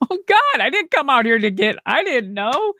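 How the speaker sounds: high, flustered disbelief; shocked surprise. Retold or imitated. Told on the spot she is hired, high flustered surprise. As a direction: sound caught off guard by the offer.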